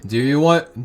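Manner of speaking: ordinary delivery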